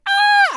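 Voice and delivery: very high, loud cheer; celebrity-style squeal of delight; cheering scream. Retold or imitated. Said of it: "the way I was cheering".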